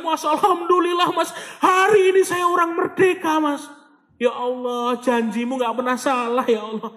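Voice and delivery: high-pitched, emotional mock-tearful cry of thanks; imitating tearful caller. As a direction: retell it like an overwhelmed caller giving thanks. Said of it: "Telepon tuh nangis-nangis". (menirukan penelepon yang menangis haru)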